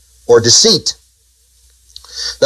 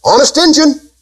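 put-on high, sing-song kid's promise; childish vow; 10 semitones higher than usual. Preacher mimics children's vow in a high childish sing-song. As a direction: chant it like a little kid swearing. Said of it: "What do they learn to say".

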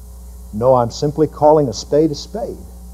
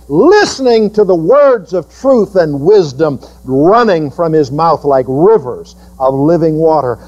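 ordinary delivery

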